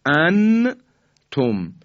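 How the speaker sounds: انتم with a clear نون, drawn out; انتم said slowly with izhar; izhar example. Shown as a demonstration. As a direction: sound the nun of انتم clearly. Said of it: "این اظهاره".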